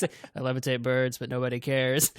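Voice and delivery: low, indifferent deadpan; Stephen Wright. Retold or imitated. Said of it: "sounds like he doesn't even care".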